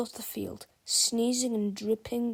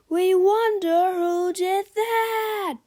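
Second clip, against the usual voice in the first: loud, high, slow mock-wondering chant; all the fans bellowing together; crowd shout; 10 semitones higher, 7 dB louder, slower than usual. Fans shout together in mock wonder, loud slow chant. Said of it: "shouted the fans in the stands".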